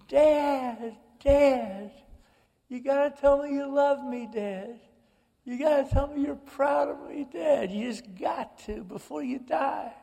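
high, pleading cry to her dying father; retold screaming plea. Sister screaming at her dying dad, high desperate plea. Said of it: "I hear Carol screaming at my father".

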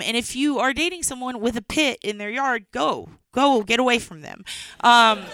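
ordinary talk

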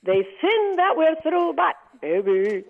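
mock Puerto Rican accent, slow sung-out baby; hammed-up heavy Puerto Rican accent; Googie Gomez accent. Rita voicing her character Googie Gomez, hammed-up Puerto Rican accent. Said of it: "Googie Gomez".

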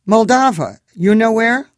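high, loud Eastern European cabbie voice; thick Moldovan accent, chatty and friendly. A narrator's reading. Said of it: "This one had an accent".

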